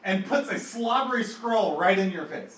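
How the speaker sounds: high, loud, urgent storytelling; excited game master narration; animated action narration. A narrator's reading. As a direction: punch out each action as it lands. Game master narrates sheep's sudden assault.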